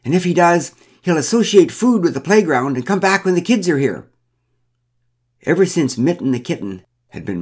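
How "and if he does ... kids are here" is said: high, loud outburst of dismay; alarmed shout. A narrator's reading. Woman sees the bear heading for the cake, shouting. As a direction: shout it urgently, pitched well up. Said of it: "She shouted".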